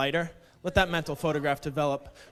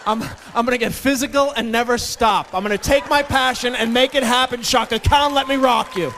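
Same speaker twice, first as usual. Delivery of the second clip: excited shouting; 6 semitones higher, 7 dB louder than usual. Retold or imitated. 80s anthem shouting.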